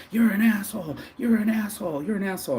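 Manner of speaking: put-on high, repeated drunken jab; drunk insult. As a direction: throw the insult again and again up high. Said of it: "He starts calling me up".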